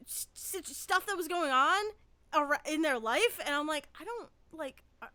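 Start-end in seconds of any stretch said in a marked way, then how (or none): 4.0-5.1 soft, faltering mutter that trails to a near-whisper; groping for words, barely voiced; hushed confusion. Confused inner reaction, hushed.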